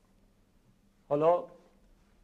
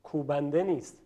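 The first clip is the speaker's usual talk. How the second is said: ordinary talk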